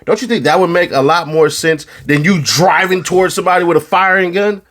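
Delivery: normal talking voice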